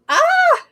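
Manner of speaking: sudden high squeal of excitement; excited shriek. Real, not acted. Host squealing as her live stream starts. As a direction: let out a burst of a shriek.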